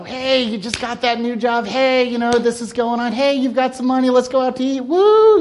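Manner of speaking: high, loud party cheer; excited friend. Acted. Preacher acts a friend cheering good news, excited whoop. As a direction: play the friend hyped about your new job.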